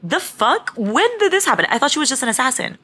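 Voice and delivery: shocked, pitch jumps high; stunned disbelief at the reveal; surprised. Real, not acted.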